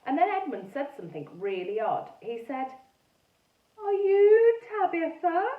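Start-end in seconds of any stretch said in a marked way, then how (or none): 3.8-5.5 put-on high, slow, loud ghost boy with a French-ish accent; strange French-ish accent. A narrator's reading. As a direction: ask it slowly in an old French-ish accent. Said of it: "He spoke with a strange accent".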